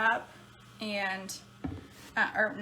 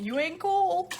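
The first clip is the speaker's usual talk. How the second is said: high, sassy tease; sassy punchline; 8 semitones higher, 3 dB louder, slower than usual. Real, not acted. Teasing viewers about minivans, sassy high tease. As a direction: toss it off with attitude.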